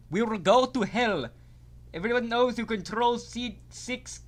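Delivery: put-on a rough stab at a Russian accent. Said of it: "a Russian accent the whole fucking time".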